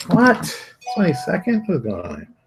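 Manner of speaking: a high, startled double take; mock shock at the date; surprised. Real, not acted. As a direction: jolt up in disbelief at the date.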